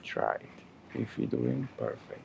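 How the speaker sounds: quiet, soothing yoga instructor voice; soft guided breathing. Acted. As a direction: gently coax the breath, softly.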